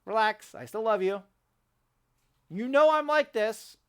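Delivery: loud but affectionate reassurance; reassuring. Real, not acted. Vlogger reassuring his wife he still loves her, affectionate. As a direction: soothe her, still half shouting.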